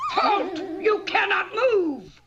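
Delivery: high, loud witch command; shrieking witch stopping a thief; witch shouting halt. Acted. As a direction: bellow it like a furious witch. Witch catches thief in garden.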